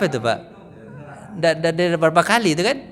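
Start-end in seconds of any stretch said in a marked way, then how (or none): none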